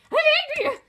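high, loud I'm angry; shouted anger. Shown as a demonstration. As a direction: yell it out with full force.

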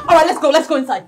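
loud, high, excited hurrying; overjoyed urging. Acted. Daughter-in-law taking mother-in-law inside, loud joyful urging. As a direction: usher them in, bursting with relief.

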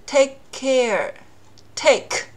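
lost plosion demo; dropped k. Shown as a demonstration. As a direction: take care with the first k unreleased, slowly. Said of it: "两个都是k".

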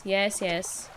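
ordinary talk, nothing marked

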